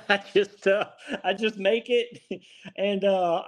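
chuckling through the words, pitch up; amused, laughing answer. Real, not acted. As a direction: laugh while you admit it. Laughing at his own answer.

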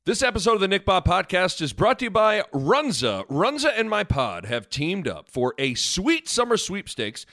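upbeat sponsor read voice. Acted. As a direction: sell it like a radio spot.

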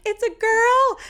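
high, loud squeal of news; re-enacted screaming announcement. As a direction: shriek it joyfully, retelling the moment. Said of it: "I immediately start screaming".

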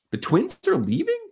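high, rising retold disbelief; incredulous question. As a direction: question the news as if you can't believe it.

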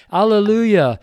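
ringing alleluia. Acted. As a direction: cry it out like a great multitude. Voice of a great multitude.

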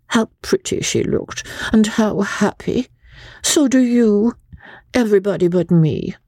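put-on high-pitched, plaintive foreign-accented lady; foreign lady, self-pitying. A narrator's reading. Rebecca tells Joss of her misery, plaintive. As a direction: play up your wretchedness to win pity.

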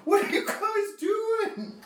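high, slow startled guard; surprised guard. Acted. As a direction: gasp it out like a baffled guard. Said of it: "The other Imperial's like".

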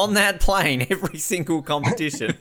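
high, loud playful demand; mock demand. Interviewer playing athlete demanding horse milk from coach, loud mock insistence. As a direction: call out an over-the-top order.

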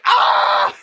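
very high unvoiced yelp; a loud surprised squeal; startled yelp. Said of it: "He's like".

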